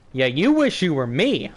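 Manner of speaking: ordinary talk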